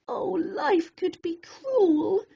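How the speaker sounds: ordinary delivery, nothing marked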